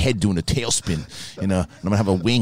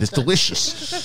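savouring in character; 9 semitones higher, slower than usual. Pretend.

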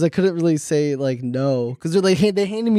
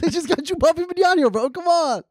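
high, excited hype; 10 semitones higher than usual. Real, not acted. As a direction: cheer it, high. Hyping the signing.

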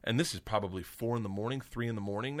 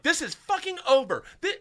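imitated high, loud outraged yell; teacher losing it; 4 dB louder than usual. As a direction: mimic a teacher exploding, pitched way up. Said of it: "loses her mind".

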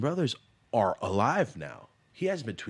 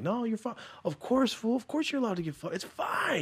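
high-pitched, humouring reassurance; placating a drunk; 13 semitones higher than usual. Retold or imitated. Speaker soothing his drunk dad like his homies, high placating voice. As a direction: imitate yourself indulging someone wasted, voice pitched way up.

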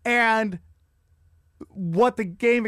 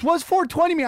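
higher, louder puzzled question; imitating Clipper Darrell; 8 semitones higher, 3 dB louder than usual. Clipper Darrell asking about the jersey, higher and puzzled. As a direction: ask it like a clueless superfan. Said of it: "Clipper Darrell goes".

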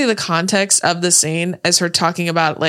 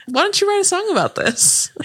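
higher mock quote; 12 semitones higher than usual. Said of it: "He's like".

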